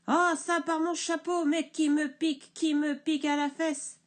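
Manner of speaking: hurle, plus aigu et plus fort; hurlement du bûcheron. Acted. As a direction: hurle de douleur et de colère. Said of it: "le bûcheron hurle". (le bûcheron piqué hurle)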